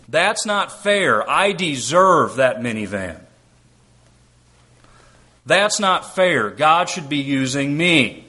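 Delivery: slow, drawn-out whine of not fair; whiny complaining child. Retold or imitated. Pastor mimics grumbling students, slow childish whine. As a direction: whine it like a middle schooler denied. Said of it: "you can just hear the cries".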